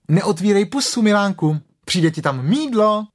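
put-on mother scrubbing her child; Kanga. A narrator's reading. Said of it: "řekla klokanice". (klokanice, vyšším mateřským hlasem)

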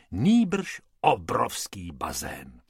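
plain reading voice

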